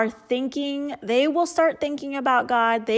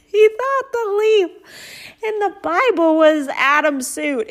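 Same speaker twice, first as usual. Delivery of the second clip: higher, louder laughing retelling; 9 semitones higher, 5 dB louder than usual. Real, not acted. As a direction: still giggling as she explains. Laughing after the punchline.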